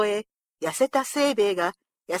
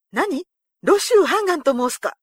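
high-pitched, startled exclamation; astonished cry; 6 semitones higher, 4 dB louder than usual. A narrator's reading. Soldiers hearing the famous general's name, crying out in astonishment. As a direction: blurt it out in shock, voice jumping up. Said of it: "思わず叫んで".

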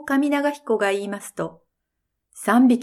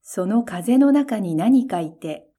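ordinary delivery

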